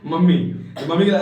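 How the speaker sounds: normal talking voice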